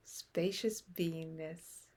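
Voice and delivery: usual speaking voice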